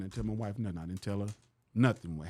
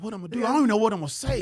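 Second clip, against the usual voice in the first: higher, louder, flustered; panicked; 12 semitones higher, 8 dB louder, much faster than usual. Retold or imitated. Alone in the green room before the show, panicked. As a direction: voice the stage panic.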